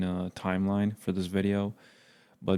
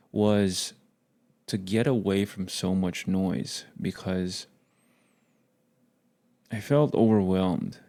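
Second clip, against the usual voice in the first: usual speaking voice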